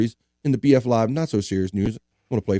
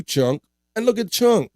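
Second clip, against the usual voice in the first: ordinary talk, nothing marked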